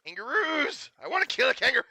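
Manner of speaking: high, loud gleeful yell; thrilled gamer yelling about kangaroos; excited shout. Real, not acted. Gamer hyped about hunting kangaroos.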